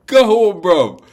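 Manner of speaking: loud high mocking shout; laughing taunt. Real, not acted. Fan taunting Liverpool, shouting with a laugh. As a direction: yell it with a laugh.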